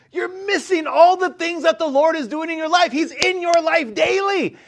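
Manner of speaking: high, intense preacher's voice; preaching fervently. Real, not acted. Preacher telling the congregation God is in their lives, fervent. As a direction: lift it to a fervent climax.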